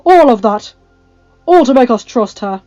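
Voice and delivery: loud, forceful insistence; suspicious. A narrator's reading. Giles doubts the old woman, suspicious. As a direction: argue it hard, distrustful. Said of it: "narrowing his eyes".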